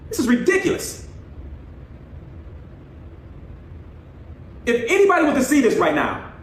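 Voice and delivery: high-pitched, exasperated scolding; outraged parent. Real, not acted. Parent confronts child over phone.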